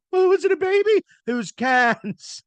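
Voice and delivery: high, loud, slowed mock gasp at the cans; mock shocked bystander. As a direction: squeal it like a startled onlooker, then drag out cans. Mocking the baby-carriage scene.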